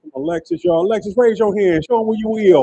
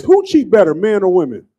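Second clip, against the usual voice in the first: ordinary talk, nothing marked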